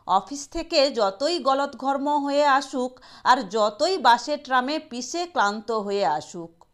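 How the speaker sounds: usual speaking voice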